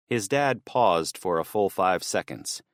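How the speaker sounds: plain reading voice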